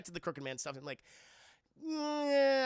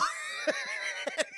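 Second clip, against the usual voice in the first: high shrieking laugh. Real, not acted. Laughing at own story.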